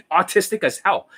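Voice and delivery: usual speaking voice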